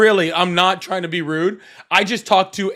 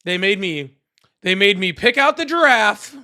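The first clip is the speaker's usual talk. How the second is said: hammed-up bragging with punched, stretched words; Donald Trump. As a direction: brag in a mock-presidential voice. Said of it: "Is my Trump impression getting".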